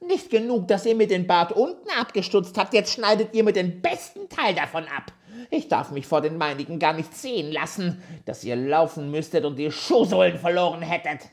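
very high, loud screeching rage; wütender Zwerg; furious dwarf shouting. A narrator's reading. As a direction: scream insults at the girls. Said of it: "schrie er sie an".

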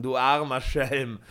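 mocking laugh. A narrator's reading. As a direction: laugh at the poor fool. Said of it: "rief jener mit Lachen". (Michel verlacht Peter, lauter und spöttisch)

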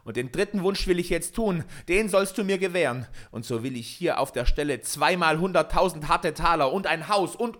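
heated, high-pitched shout. A narrator's reading. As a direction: cry out your demand, building in force. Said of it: "fasste er das Männlein unsanft am Kragen und schrie".

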